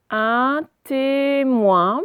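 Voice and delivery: "Un témoin" drawn out very slowly; slow reading. Shown as a demonstration. Teacher reading the word aloud for pupils: very slow. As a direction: stretch every sound of "témoin". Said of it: "teacher reading word aloud".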